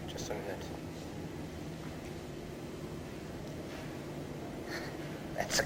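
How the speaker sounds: hushed, tense whisper, barely voiced. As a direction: whisper it, freezing at the sight. Spots a bug, hushes.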